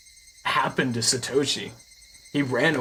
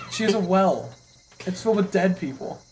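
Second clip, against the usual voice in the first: excited; 7 semitones higher than usual. Real, not acted. Excited rant continues.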